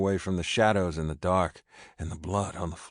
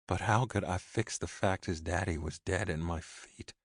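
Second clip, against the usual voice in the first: ordinary delivery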